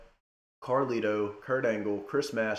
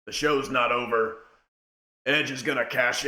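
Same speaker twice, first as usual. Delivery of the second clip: imitating Vince; faster than usual. Vince halting the show, mock announcer call. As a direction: boss halting the show on the mic. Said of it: "Vince comes out and it, and says".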